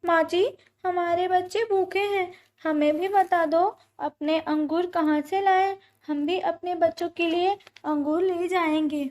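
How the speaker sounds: high, imploring bird voice; pleading mother bird. A narrator's reading. Mother bird asking old pigeon about grapes, pleading.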